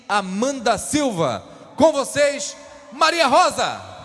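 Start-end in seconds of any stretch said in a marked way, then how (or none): 1.8-4.0 loud, drawn-out stage announcement; announcer shout. Real, not acted. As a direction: call her name out to the crowd. Announcing the queen's entrance.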